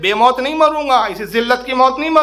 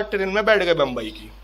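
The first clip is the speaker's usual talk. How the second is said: ordinary talk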